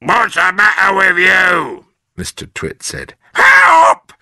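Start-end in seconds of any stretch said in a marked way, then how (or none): 0.0-1.9 put-on higher, loud gruff old man; Mister Twit. A narrator's reading. Husband answering scream. 3.4-4.1 very high, loud panicked shriek; scream. A narrator's reading. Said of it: "screamed Missus Twit".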